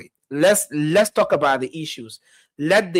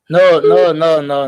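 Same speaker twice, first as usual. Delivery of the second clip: loud; 9 dB louder, slower than usual. Real, not acted. Host cuts off ranting caller.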